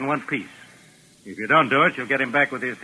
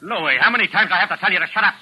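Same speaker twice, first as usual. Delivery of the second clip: fast, louder irritated rebuke; exasperated scolding; 6 semitones higher, 4 dB louder, faster than usual. Acted. Gang boss rebuking his henchman for interrupting, fast and irritated.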